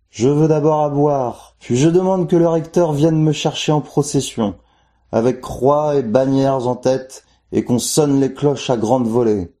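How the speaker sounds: high, slow, solemn demands; the saint in the well issuing his demands, high and slow; demanding saint voice. A narrator's reading. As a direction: lay down your terms slowly from the well.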